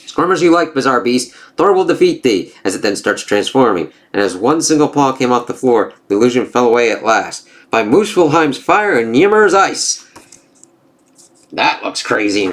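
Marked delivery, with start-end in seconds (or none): 0.0-2.5 lightly voiced gods trading taunts; storybook reading of a Norse comic; comic dialogue read-aloud. 7.7-10.0 slow, higher mock-epic oath; hammed-up mythic exclamation; dramatic incantation. Reads comic dialogue aloud.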